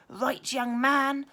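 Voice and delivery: slow, loud, high stern call; a teacher booming to stop the fight; loud slow telling-off; cried out. A narrator's reading. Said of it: "cried Mister Irons".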